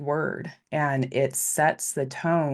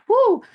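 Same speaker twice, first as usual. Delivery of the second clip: a loud, much higher whoop of delight; excited woo; 9 dB louder than usual. Real, not acted. Cheering the change in workplace talk with a loud whoop. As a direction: let out a big celebratory whoop.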